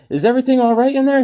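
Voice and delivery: high loud fast call; loud high fast mother. Retold or imitated. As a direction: call through the wall like mom. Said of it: "she goes".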